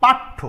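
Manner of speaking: teacher's model word; pronounce paththo. Shown as a demonstration. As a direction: hold the doubled th in পাঠ্য. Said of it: "অথবা দেখো".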